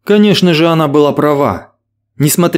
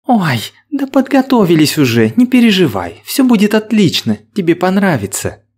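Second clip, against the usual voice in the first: eager seductive woman; 4 semitones higher than usual. Acted. Angelina seducing.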